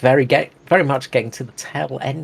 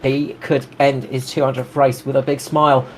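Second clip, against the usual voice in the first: normal talking voice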